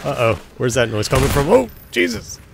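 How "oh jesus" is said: high, jolted exclamation; startled outburst. Real, not acted. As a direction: exclaim as if suddenly jumped. Startled by noise in game.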